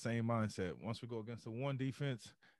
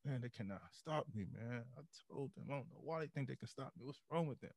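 softer cocky muttering; muttering to self; 2 semitones higher, 7 dB quieter than usual. Retold or imitated. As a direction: mutter it smugly under your breath. Muttering to himself jogging back.

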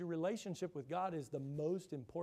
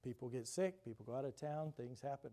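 ordinary delivery

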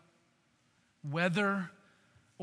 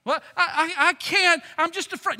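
higher, louder, stammering fear; mock afraid; 9 semitones higher, 7 dB louder than usual. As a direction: stammer like someone scared. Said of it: "acting out the fearful believer".

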